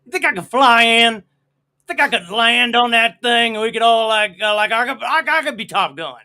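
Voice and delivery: a hint of cocky Bush swagger; George Bush impression. Host does George Bush for cohost, lightly voiced. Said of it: "That's my George Bush impression".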